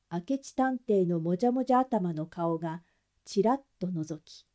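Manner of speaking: ordinary delivery, nothing marked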